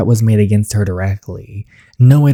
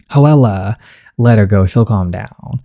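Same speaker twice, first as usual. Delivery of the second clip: normal talking voice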